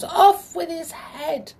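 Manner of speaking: high, slow, loud cry of command; cried out. A narrator's reading. King repeating his order to the soldiers, cried out. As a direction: draw out the royal order at high pitch. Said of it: "he cried to the".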